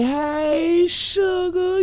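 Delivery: high, sing-song falsetto "Hey, sugar"; sugary falsetto greeting. Acted. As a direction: coo it up high like a sweet-talker. Signature greeting to the chat.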